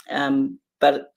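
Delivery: normal talking voice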